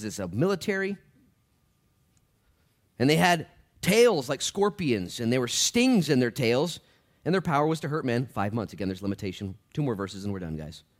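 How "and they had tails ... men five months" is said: emphatic preacher reading aloud; dramatic scripture reading. Acted. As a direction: proclaim the verse forcefully, stretching key words. Reads scripture at full force.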